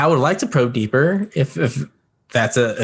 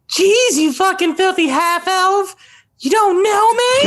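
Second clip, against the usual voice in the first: much higher, slow, sneering creature voice; high mocking telepathic voice; 4 dB louder than usual. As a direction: jeer at the half-elf in a high warped voice. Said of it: "I'm like".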